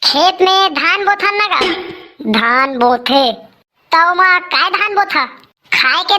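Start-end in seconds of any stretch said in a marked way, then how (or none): 2.3-3.3 slow dictation. Acted. Repeats answer while writing.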